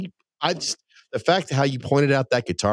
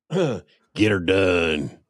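slow drawn-out catchphrase impression; Larry the Cable Guy catchphrase; 3 semitones lower, much slower than usual. Host imitating Larry the Cable Guy's catchphrase, slow and drawn out. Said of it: "do you guys remember this one".

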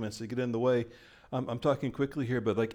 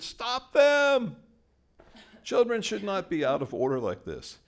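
much higher, louder outburst of stern rebuke; indignant rebuke; 10 semitones higher, 5 dB louder than usual. Retold or imitated. Pharisees scolding the worshipping children, higher and louder. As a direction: snap it out like an offended elder.

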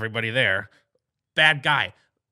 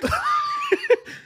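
shrieking very high laugh; leprechaun cackle; 6 dB louder than usual. Acted. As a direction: cackle wildly in a tiny voice. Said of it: "that one was much better".

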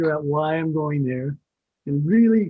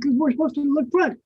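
much higher, earnest protest; naive young actor; 9 semitones higher than usual. Retold or imitated. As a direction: play the naive newcomer.

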